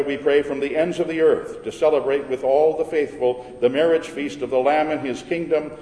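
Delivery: ordinary delivery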